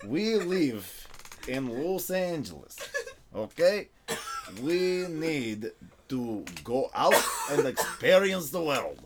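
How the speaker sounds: slow, drawn-out mock Australian accent; comic Australian accent, word by word. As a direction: put on a hammy Australian accent, stretching the words. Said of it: "That was my Australian accent".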